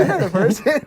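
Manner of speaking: loud, high mimicry with laughter; giggly imitation of a film line; laughing quote.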